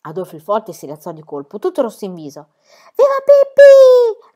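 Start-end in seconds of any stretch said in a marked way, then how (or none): 2.9-4.3 much higher, much louder, slow cheer; cheering shout. A narrator's reading. Tommy and Annika cheer Pippi, shouting. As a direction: cheer her name to the rafters. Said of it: "gridarono Tommy ed Annika".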